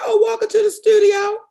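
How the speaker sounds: high, loud, bright-eyed answer; high loud innocent reply; cheery reply. Retold or imitated. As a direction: call it out like it's nothing. Retelling her cheerful naive answer.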